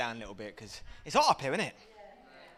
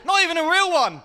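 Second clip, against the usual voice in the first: high, loud comic indignation; mock outrage; 9 semitones higher, 6 dB louder than usual. Comic ranting about imitation biscuits, high mock outrage. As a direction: protest loudly, voice pitched right up.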